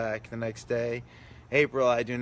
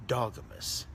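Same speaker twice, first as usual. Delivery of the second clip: normal talking voice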